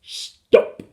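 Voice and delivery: loud, forceful one-word command; sharp, loud call. A narrator's reading. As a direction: shout it down at intruders. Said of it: "called the tiny Mister Gregg".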